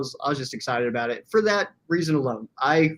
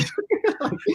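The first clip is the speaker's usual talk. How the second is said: high laugh. Real, not acted. Laughing at his own story.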